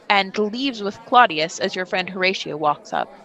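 ordinary talk

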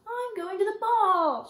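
loud, drawn-out, high play-acting; high pretend voice. A narrator's reading. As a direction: sing out in a high doll voice. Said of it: "in a high, pretend voice".

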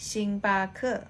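"ชิงบาเคอ" said as Mandarin xing-ba-ke with a rising end; "ชิงบาเคอ" in Chinese tones; Mandarin reading. Shown as a demonstration. Teacher giving the Chinese name for Starbucks, Mandarin tones. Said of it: "จะอ่านว่า".

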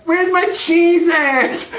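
high, drawn-out nerd whine; Steve Urkel. Acted. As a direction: whine it slowly as a squeaky sitcom nerd. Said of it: "And you see Urkel's like".

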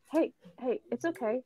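soft, soothing reassurance; calm. Acted. Player's character going up to the scared kid, calm and soft. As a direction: approach gently and keep it quiet. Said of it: "go up to the kid calmly and be like".

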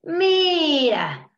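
loud, drawn-out mock grumble; growled. A narrator's reading. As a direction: grumble it like a cross walrus. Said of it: "gruñó la señora Morsa".

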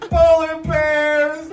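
high slow wail; hammed-up high wail of polar bears. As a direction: stretch it out wailing. First wail.

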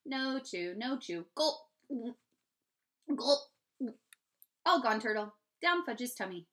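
lightly voiced toddler talk; short babyish bursts with acted gulps; babbling. A narrator's reading. Fudge shows how he swallowed the turtle, babbling. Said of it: "Fudge babbled".

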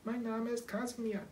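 high little cat voice; kitten voice. Acted. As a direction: confirm it like a tiny kitten. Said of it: "bestätigte das Kätzchen". (hohe Kätzchenstimme)

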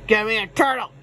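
ordinary talk, nothing marked

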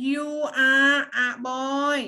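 lightly voiced little girl, slow; girl's voice. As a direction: speak as the girl in the book. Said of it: "เด็กผู้หญิงเขาพูดขึ้นก่อนว่า". (เสียงเด็กผู้หญิงเบาๆ ช้าๆ)

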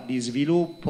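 usual speaking voice

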